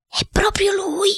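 high, half-whispered conviction; convinced exclamation. Acted. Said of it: "il cuoco esclamò convinto". (esclamazione convinta, voce acuta)

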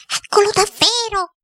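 high-pitched woman's exclamation; exclaiming. Acted. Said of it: "la signora Geltrude esclamò". (esclamazione stupita, voce acuta)

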